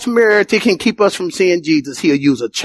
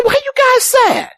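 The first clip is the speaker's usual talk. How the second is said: much higher, gentle questioning voice; Jesus asking. Retold or imitated. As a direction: ask the sad couple why they grieve.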